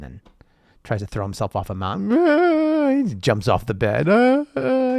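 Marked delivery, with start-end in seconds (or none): 2.1-2.9 put-on high, loud whiny wail like a child; mock a whining kid; a hammed-up childish whine. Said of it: "he sounds like a whiny kid". 4.1-5.0 put-on high, loud whiny wail like a child; mock a whining kid; a hammed-up childish whine. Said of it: "he sounds like a whiny kid".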